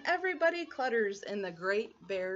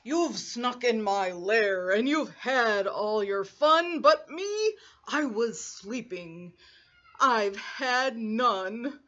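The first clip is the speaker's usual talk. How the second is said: a big sulky bear grumbling; grumpy bear; 4 dB louder than usual. Acted. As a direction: grumble out your complaint.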